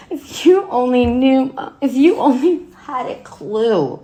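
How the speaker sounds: high, loud cry of hurt; anguished reproach. Acted. Daughter reproaching her dead mother, anguished.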